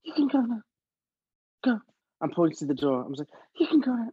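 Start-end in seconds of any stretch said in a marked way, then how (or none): 0.0-1.8 tiny, high squeak of a voice; timid runner. Retold or imitated. Poor runner dismissing an actor from an audition, high mock squeak. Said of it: "And she goes". 3.6-4.1 high, squeaky runner voice; timid runner impression. Actor mimicking the runner sending them away, high squeak. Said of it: "I quite like that impression".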